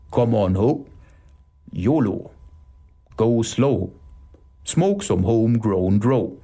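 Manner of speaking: drawling stoner with long pauses; laid-back Otto. Acted. Otto telling the others to go slow, laid-back. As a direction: stretch the vowels, totally chilled. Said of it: "tont Otto".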